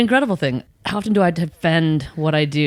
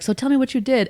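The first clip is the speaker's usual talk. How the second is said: mock curious grandkid; 7 semitones higher, faster than usual. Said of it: "they're like".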